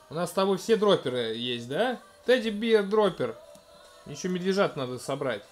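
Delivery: ordinary talk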